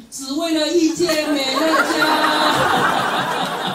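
very slow, high, loud shout with a laugh; loud proclamation. Pretend. Friend proclaims to the audience why he came, a loud slow shout. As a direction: stretch every word out and proclaim it. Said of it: "他就很大声说".

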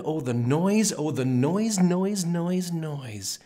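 mounting noise then drop; rising din. A narrator's reading. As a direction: climb with each noise like the racket grows. Narrator mimics the Whos' racket.

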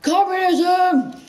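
high, loud, slow drawn-out shout; dramatic cry. Pretend. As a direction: proclaim it like a big reveal.

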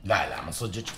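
ordinary delivery

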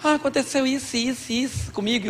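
high, soft mock wife voice; wife recounting. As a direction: imitate a wife softly pouring out her troubles. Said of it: "Às vezes ela fala comigo".

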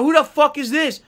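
high, loud "who the fuck" at the other driver; outraged yell. Retold or imitated. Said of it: "I go".

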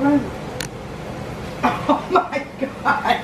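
ordinary talk, nothing marked

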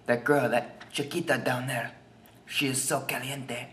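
softly spoken mock Spanish accent; put-on Spanish accent. Shown as a demonstration. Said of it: "sort of an accent".

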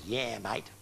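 low, soft Australian truckie drawl; a truckie on the CB answering with a lazy Australian yeah mate; Aussie trucker voice. Retold or imitated. Preacher imitating a truckie on the radio, a laid-back Australian drawl. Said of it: "all these Aussie voices".

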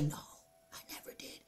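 whispered retort; whispered shy denial to the girlfriend. Retold or imitated. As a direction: whisper it sheepishly.

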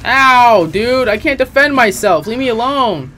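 panicked. Real, not acted. Attacked by a monster in game.

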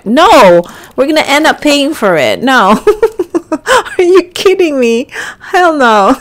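high, loud indignant refusal; hammed-up incredulous protest, laughing midway; playful outrage. Player turning down Jason's costly dinner date, mock outrage.

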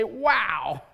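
high, wholehearted wow; amazed exclamation. Real, not acted. Preacher at the pulpit amazed by the miracle, exclaiming. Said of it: "but I want to say".

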